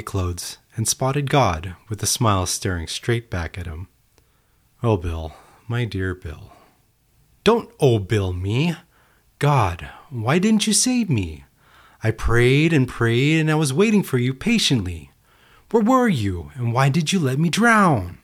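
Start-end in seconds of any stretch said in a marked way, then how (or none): 4.8-6.5 tender God. Acted. God greets drowned man tenderly. 7.4-18.2 indignant reproach. Acted. Drowned man reproaches God.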